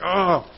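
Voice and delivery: tired groan of aggravation; frustrated groan. Retold or imitated. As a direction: let out a fed-up groan. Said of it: "and you're like".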